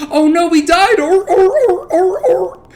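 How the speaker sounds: very high, loud barking squeals; seal-bark squeal. Retold or imitated. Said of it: "barking like a seal".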